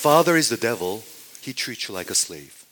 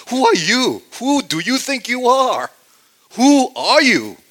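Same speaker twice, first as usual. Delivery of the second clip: loud, high, challenging demand; the crowd challenging Jesus, high and loud; hostile demand; 13 semitones higher, 11 dB louder than usual. Retold or imitated. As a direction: confront him like an angry mob. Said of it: "That's the tone".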